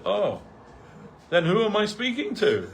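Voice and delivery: high and loud, laughing as the words come out; can't hold back a laugh at the punchline; retelling the guru's startled question, cracking up; laughing through quote. Retold or imitated. Quoting guru, breaking into laughter.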